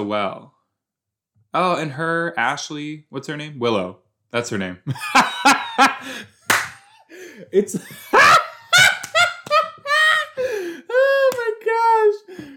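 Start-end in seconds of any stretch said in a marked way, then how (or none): 4.8-6.2 high, loud cackling burst; bursting laugh. Real, not acted. Laughing at her own name slip, high cackle. 7.8-10.9 very high, loud squealing laughter; helpless laughing fit. Real, not acted. Viewer cracks up at her own mistake, helpless squeals. 10.9-12.5 high squeal through laughter; helpless laughing. Real, not acted. Laughing at her own mistake, high drawn-out squeal. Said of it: "I have to laugh at myself".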